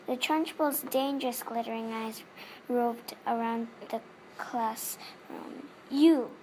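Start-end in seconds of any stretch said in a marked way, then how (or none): 5.9-6.3 barked loud and sharp; single out a small girl with a loud bark; sudden loud call. A narrator's reading. Headmistress picking a child out loudly. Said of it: "she said".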